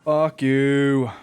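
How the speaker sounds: ordinary talk, nothing marked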